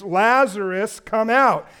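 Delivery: loud, commanding call. Retold or imitated. Preacher voices Jesus calling into the tomb, loud call. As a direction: call out as if to the dead. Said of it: "called out in a loud voice".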